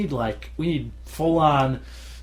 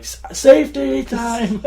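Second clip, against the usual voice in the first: slow, much higher and louder plea; mock panic call; 12 semitones higher, 7 dB louder, slower than usual. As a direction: squeal it like you're giving up. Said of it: "put your hands up and be like".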